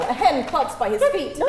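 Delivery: ordinary talk, nothing marked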